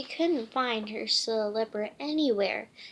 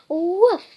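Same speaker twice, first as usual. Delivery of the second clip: loud woof; dog bark; 7 semitones higher, 12 dB louder than usual. Acted. Dog barking to comfort upset girl.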